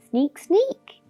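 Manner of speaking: usual speaking voice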